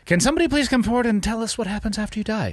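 high, pleading call-out; hammed-up plea for answers; mock plea. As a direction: beg the crowd in a high mock voice.

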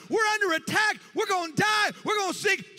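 panicked disciples. Retold or imitated. Disciples shouting in terror at Jesus during the storm, panicked. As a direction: cry out that the boat is sinking. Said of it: "The disciples freaking out".

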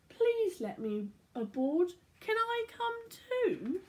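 a light, high, slow plea; high little-boy asking to join; pleading boy. Acted. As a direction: beg sweetly like a small boy. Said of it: "What about Tom? Could he join the crew?".

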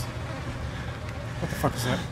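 slow, hushed whisper; frightened whisper in the dark; tense whisper. Real, not acted. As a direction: breathe it out, afraid to be heard. Sneaking through dark room.

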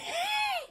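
shrieking burst of laughter; a sudden high squeal of a laugh. Real, not acted.

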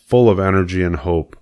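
ordinary delivery

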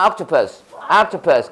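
"옥토퍼스" low and falling, AHK-tuh-pus; English-style octopus. Shown as a demonstration. Teacher drilling students, "옥토퍼스" as AHK-tuh-pus. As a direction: open "옥토퍼스" between a and o. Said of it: "아 발음도 아니고 오 발음도 아니야".

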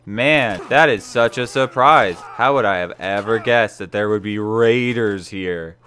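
loud, overdone mock amazement; booming sarcasm about the raiders, stretched out; sarcastic. Real, not acted. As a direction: ham up fake shock at the obvious. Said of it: "Could you tell that I'm being sarcastic?".

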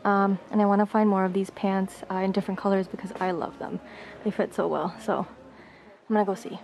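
ordinary talk, nothing marked

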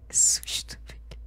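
breathless whisper. Real, not acted. After jump scare.

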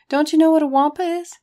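high, loud excited question. A narrator's reading. Narrator quizzes his clueless partner, excited. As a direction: ask it in a rush of excitement. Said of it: "I was excited".

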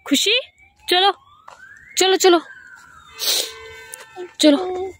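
short, high, loud calls. Real, not acted. Calling the child to come along.

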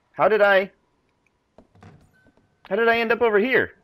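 high-pitched, startled confusion; bewildered. Real, not acted. Game player finding himself somewhere unexpected, bewildered surprise. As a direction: pitch leaps up in sudden bafflement.

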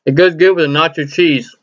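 talking between bites; chewing while talking. Real, not acted. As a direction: mouth partly full of chip. Seen 4: Chip goes in at -4; lips still pursed, chewing while speaking.

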